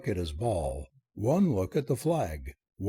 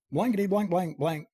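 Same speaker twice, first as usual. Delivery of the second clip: high, sputtering censored cursing; pitched-up comic outburst of swearing; cried oath; 6 semitones higher than usual. A narrator's reading. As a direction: cry it out like a string of oaths. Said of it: "cried Chester".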